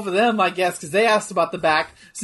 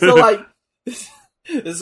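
laughing through words; 3 semitones higher, 9 dB louder, much slower than usual. Real, not acted. Laughing recounting video.

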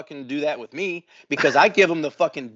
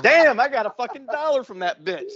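laughing through the words, pitched up; amused disbelief; 8 semitones higher than usual. Retold or imitated. Teller laughs telling his friend about the free dollar. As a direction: giggle out the brag.

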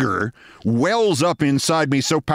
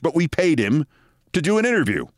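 usual speaking voice

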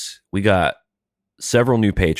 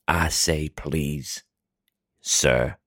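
florid gentleman orator; much slower than usual. Acted. Co-host bidding sir go on, as a florid orator. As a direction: grant permission with drawn-out grandiosity.

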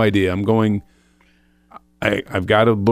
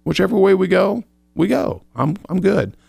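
high, breezy, care-free sing-song; high airy shrug of a prayer handing it all over; carefree; 11 semitones higher than usual. Retold or imitated. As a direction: toss the worry up to God, lightly. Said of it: "I'm like".

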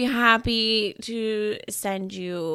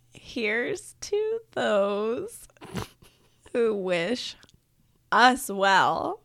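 slow, drawn-out toast; 4 dB louder, slower than usual. Shown as a demonstration. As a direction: stretch every word slowly. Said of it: "read my one sentence very slowly".

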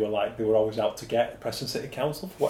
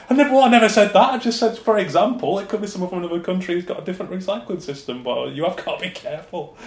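loud, higher insistence; indignant protest; 7 semitones higher, 6 dB louder than usual. Retold or imitated. Officer protesting he never slammed students. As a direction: protest your innocence hard. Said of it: "I'm like".

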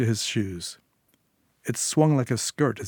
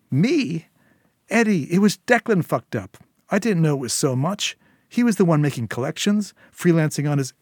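higher, anxious pleading; desperate henchwoman; 6 semitones higher, 5 dB louder than usual. A narrator's reading. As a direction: justify yourself fast, scared of him. Syl protests to Eddie.

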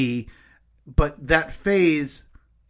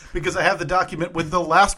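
normal talking voice

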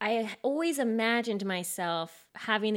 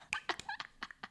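high breathless laugh; 12 dB quieter than usual. Real, not acted. Laughing at Marcel joke.

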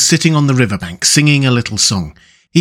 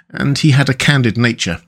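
usual speaking voice

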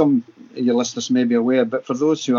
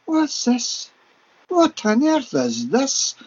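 high, astonished cry of wonder; excited disbelief; 10 semitones higher, much slower than usual. Retold or imitated. To the psychic as the lights appear, astonished and excited. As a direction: gasp it out in amazement, then slow down on the second question. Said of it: "I was quite excited".

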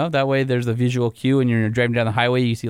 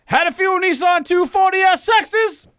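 blustery Southern rooster voice; 14 semitones higher, 3 dB louder than usual. Acted. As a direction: read the letter like a loud cartoon rooster. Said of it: "I don't think Foghorn Leghorn would be driving".

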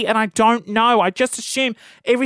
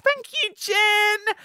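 high, slow, sweet thanks; sugary thank you; 11 semitones higher, much slower than usual. Retold or imitated. Customer thanking Jen at the counter, sugary and slow.

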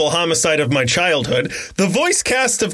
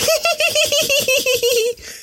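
a much higher squeaky giggle; new laugh. Shown as a demonstration. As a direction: show off the changed laugh. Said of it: "And now my laugh is".

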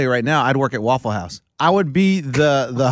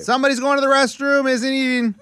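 called out; 9 semitones higher than usual. Retold or imitated. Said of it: "Don't need to yell that".

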